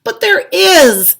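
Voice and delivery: slow, loud, high protest; child pleading, drawn out; insistent child. A narrator's reading. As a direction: insist with all your might. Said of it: "I said".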